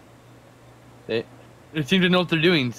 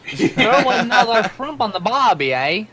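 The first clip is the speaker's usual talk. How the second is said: higher, slow mock Australian accent; terrible Australian accent; 8 semitones higher, 6 dB louder, slower than usual. Friend repeats the joke in a bad mock Australian accent. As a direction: echo the line in a cartoon Australian accent. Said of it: "It's terrible".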